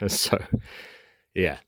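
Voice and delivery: ordinary talk, nothing marked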